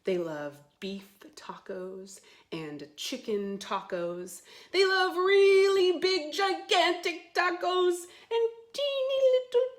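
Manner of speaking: loud, lively storybook read; reading to children. Acted. Teacher reading the dragon book aloud to kids, loud and bouncy. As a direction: sell every taco like a big surprise.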